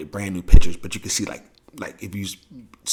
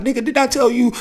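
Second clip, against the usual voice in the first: mock uncle scold; 13 semitones higher, 4 dB louder than usual. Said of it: "my uncle would be like".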